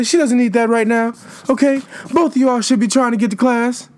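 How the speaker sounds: high, breathy school disciplinarian scolding students; breathy disciplinarian impression. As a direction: play the out-of-breath school disciplinarian calling kids out. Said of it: "that used to talk like that".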